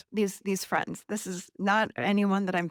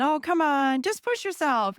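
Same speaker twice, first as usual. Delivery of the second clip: mock friend nagging; 5 semitones higher than usual. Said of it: "I hate when people say that to me".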